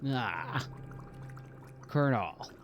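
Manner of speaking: low gruff soldier voice; Snake impression. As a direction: growl it like a grizzled spy. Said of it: "work on my Snake impression".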